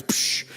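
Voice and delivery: short, soft "psh" of a launch; quick hissed whoosh of air; imitated launch whoosh. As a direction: mimic a coaster whooshing off.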